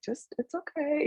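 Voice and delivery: soft, high-pitched self-reassurance; benevolent inner voice. Retold or imitated. Kind inner voice coaxing her through morning dishes, tenderly encouraging. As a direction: gently soothe yourself, light and high. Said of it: "this voice of consciousness, ... benevolent".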